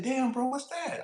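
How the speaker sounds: high, startled question; surprised buddy. Retold or imitated. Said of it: "He said".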